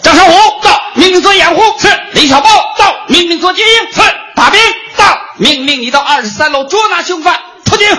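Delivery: high barked roll call; stiff barking toy soldier. Acted. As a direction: shout each name like a drill officer. Commander roll call shouting.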